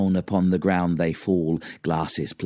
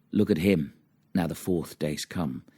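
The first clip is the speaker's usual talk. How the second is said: ordinary delivery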